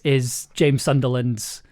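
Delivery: ordinary delivery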